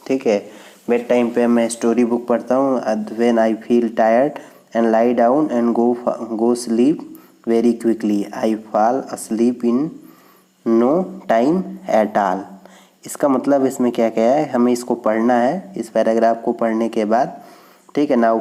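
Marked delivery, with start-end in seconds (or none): 2.8-12.4 slow, careful classroom reading; slow read-aloud. Shown as a demonstration. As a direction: read it out word by word. Reads text slowly. (धीमी गति से पाठ पढ़ना)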